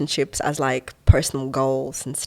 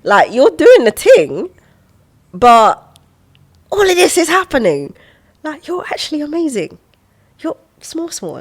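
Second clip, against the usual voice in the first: self pep talk; 10 semitones higher, 9 dB louder than usual. Retold or imitated. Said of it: "I was just like".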